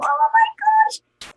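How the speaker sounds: squealing high and slow with joy; a slow high squeal of delight; giddy excitement. Retold or imitated. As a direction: gush to the roommates, very high. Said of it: "I'm telling my roommates".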